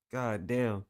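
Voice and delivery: ordinary delivery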